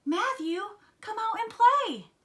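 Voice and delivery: very high, loud call-out; hollering. A narrator's reading. Froggy outside Matthew's house, high loud holler. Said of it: "he hollered".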